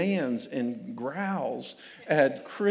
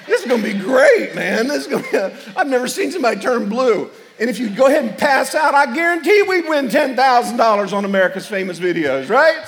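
loud, high laughing glee; hammed-up delight at the tantrum; laughing excitement; mock glee; 6 semitones higher, 10 dB louder than usual. Parent gleefully watching tantrum.

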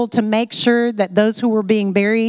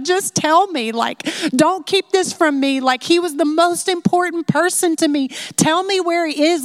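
higher, pleading, mock-desperate Mary; higher-pitched imitation of a grieving woman begging; distraught pleading; 7 semitones higher than usual. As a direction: beg for an answer, overcome with emotion. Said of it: "she's so overcome by emotion".

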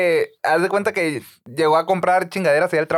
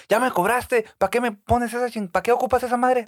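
turista enojado imitado; 6 semitones higher than usual. Retold or imitated.

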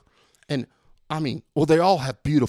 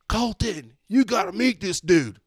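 high, slow drawn-out call; 7 semitones higher, 3 dB louder, slower than usual. Retold or imitated. Walker summons Colton to meet a fan, calling out. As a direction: call a teammate over, stretching it out.

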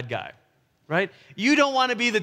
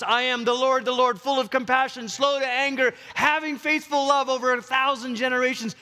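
high-pitched, ringing divine declaration; loud proclamation; 6 semitones higher than usual. Acted. The Lord revealing who he is to Moses, proclaiming. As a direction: proclaim each title in a sustained high pitch. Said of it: "proclaims in a loud voice".

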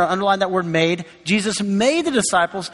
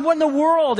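higher, mock exasperated cry to God; imitating a frustrated believer, pitched up; bewildered; 9 semitones higher than usual. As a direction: throw up your hands, higher. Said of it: "sometimes we go".

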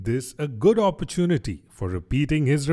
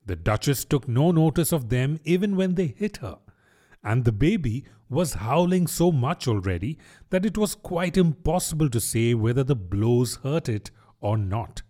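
ordinary delivery, nothing marked

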